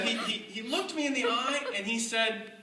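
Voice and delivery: usual speaking voice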